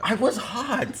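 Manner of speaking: high-pitched protest; mock protest. [name] told never to say that sentence again, protesting high.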